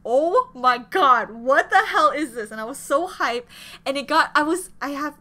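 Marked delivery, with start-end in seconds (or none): 0.1-2.5 slow, loud, stunned "oh my god"; excited reaction. Retold or imitated. Retelling her first listen, loud and amazed. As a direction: blurt it out amazed at the song. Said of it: "and I'm like".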